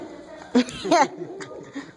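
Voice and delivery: short, pleased chuckle; gleeful laugh. Acted. Storyteller owning up to biting the conductor, gleeful laugh. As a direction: laugh at your own boldness.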